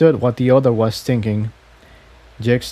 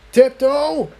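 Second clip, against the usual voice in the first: high called name, pitched up; yell; 13 semitones higher than usual. A narrator's reading. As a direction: call her name over the chase. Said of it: "Jigsaw yelled".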